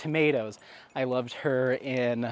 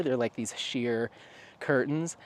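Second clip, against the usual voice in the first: normal talking voice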